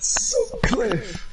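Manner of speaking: mimicking the blurt, squeaky and very high; mock outburst. Mocking friend's blurted teacher name, squealed very high. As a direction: echo the shout in a silly high squeal. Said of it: "the amount of force that came out with".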